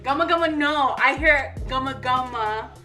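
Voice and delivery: usual speaking voice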